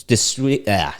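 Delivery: ordinary talk, nothing marked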